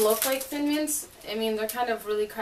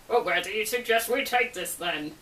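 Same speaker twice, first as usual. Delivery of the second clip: dark chuckle. A narrator's reading. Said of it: "He chuckled darkly and finished his sentence".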